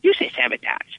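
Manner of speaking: high elf voice quoting Shatner's retort; elf homage to Shatner. Retold or imitated. Elf actor answering director, high-voiced homage. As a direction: lift the pitch high, mimic the famous comeback. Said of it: "did an homage to his delivery".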